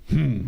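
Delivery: short, high, loud "hum"; imitated groan. Retelling how the girl went "hum", imitated groan. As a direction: mimic the possessed girl's sudden hum. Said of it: "a moça fez".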